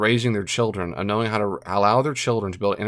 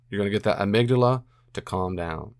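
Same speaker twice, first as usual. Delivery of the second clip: normal talking voice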